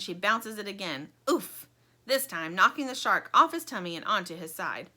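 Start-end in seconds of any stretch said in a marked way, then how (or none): none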